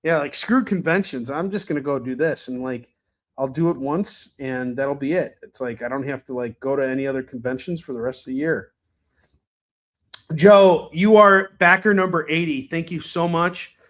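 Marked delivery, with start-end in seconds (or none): none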